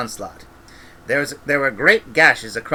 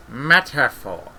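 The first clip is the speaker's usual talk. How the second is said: calm Granny; slower than usual. Acted. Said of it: "said Granny, calmly".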